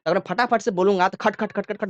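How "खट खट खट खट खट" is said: quick staccato run of repeated beats; rapid-fire. Shown as a demonstration. Teacher showing how quickly oscillations come, rapid-fire. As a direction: rattle the syllables off fast. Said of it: "तेजी से, फटाफट बोलूं".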